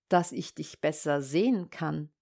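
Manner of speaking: low, soft wolf's reply; wolf posing as grandmother. Acted. Disguised wolf answers the girl.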